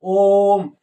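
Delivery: chant-like drawn-out ओम. Shown as a demonstration. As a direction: draw ओम out slowly like a chant. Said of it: "प्लुत स्वर कहलाता है".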